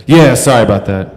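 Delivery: acted, too-loud apology; loud false apology. Talker brushing off the audience, loudly mock-apologetic. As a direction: toss out a loud, insincere sorry.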